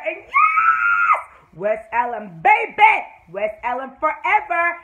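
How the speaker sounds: high, very loud squeal of joy; wild, whooping celebration; ecstatic fan shout. Real, not acted. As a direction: scream it out like a superfan. Fan celebrates romantic scene.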